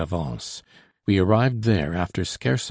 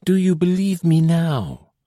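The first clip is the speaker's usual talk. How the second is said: put-on high, louder, anxious young woman's plea; girl urging the patrol to believe her; anxious exclaim; 9 semitones higher, 5 dB louder than usual. A narrator's reading. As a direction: beg to be believed. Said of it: "she exclaimed in tones of deep anxiety".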